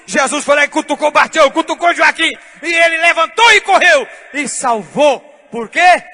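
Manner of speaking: loud, high, driving sermon climax; preaching at full force. Real, not acted. Pastor telling the rescue story, loud preaching climax.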